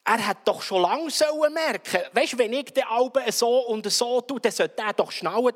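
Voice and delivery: complaining wife. Retold or imitated.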